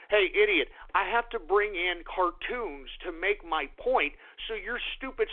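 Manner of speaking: ordinary delivery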